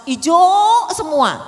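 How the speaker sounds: HIJAU stretched long, high and loud; drawn-out emphasis on hijau. Shown as a demonstration. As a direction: swell loudly on "hijau", dragging it out. Exaggerates an all-green decoration.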